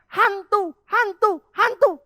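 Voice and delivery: loud, high cries, each word separate; frightened disciples' shout. Retold or imitated. Disciples see Jesus on the water and yell, shouting. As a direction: cry out in alarm, stretching each word. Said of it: "Mereka teriak".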